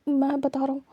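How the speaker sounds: ordinary delivery, nothing marked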